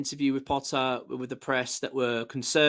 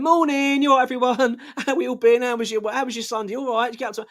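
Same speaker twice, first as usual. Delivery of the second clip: higher and louder, breezy good-morning manager; mock cheery greeting; 9 semitones higher, 4 dB louder than usual. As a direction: sing out a jolly hello to the squad. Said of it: "Graham Potter going".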